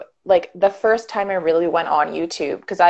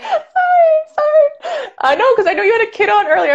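high, fast laughing "sorry" that cracks like a sob; laughing apology; 3 dB louder than usual. Real, not acted. Apologising to the host for swearing, laughing high. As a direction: apologise through a giggle, pitched way up.

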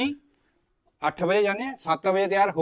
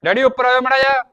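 loud call up the stairs, higher; raised voice calling father upstairs; 5 semitones higher, 9 dB louder than usual. Acted. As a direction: shout up to dad from below. Son calls father upstairs.

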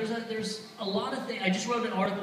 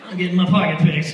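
loud realisation; 10 dB louder than usual. Retold or imitated. Own realisation voiced during the hug.

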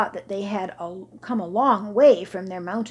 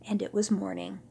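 ordinary delivery

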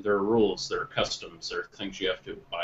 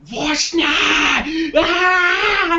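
shrieking furious scream, very high; raging scream; 13 dB louder, much slower than usual. Retold or imitated. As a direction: scream in gamer rage. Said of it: "and he's like".

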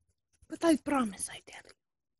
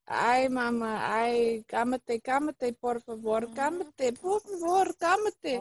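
pleading, rising Spanish plea; Spanish phrase, Russian accent; 6 dB louder, slower than usual. Acted. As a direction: beg her to calm down. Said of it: "I learned a new American phrase".

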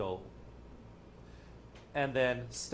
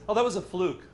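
higher, louder, dismissive; indignant demon; 7 semitones higher, 6 dB louder than usual. Retold or imitated. Demon denying the success, higher and louder. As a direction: insist it was luck. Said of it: "it says".

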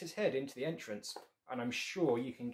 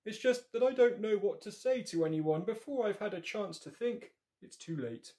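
higher, lightly voiced shy bear; 8 semitones higher, 5 dB louder than usual. Shy Bear tells the frog why he stares, higher voice.

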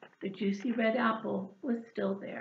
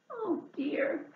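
sighed; 7 semitones higher than usual. Acted. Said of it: "sighed Hedgehog".

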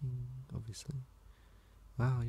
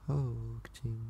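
ordinary talk, nothing marked

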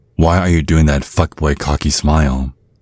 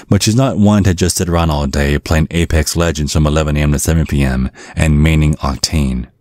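normal talking voice

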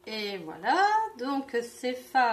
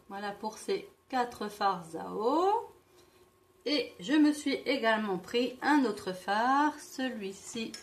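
ordinary delivery

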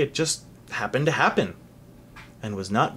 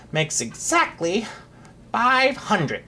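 winded, higher-pitched tally; tired voice; 6 semitones higher, slower than usual. A narrator's reading. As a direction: finish the count wearily, out of breath. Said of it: "broke in the tired voice".

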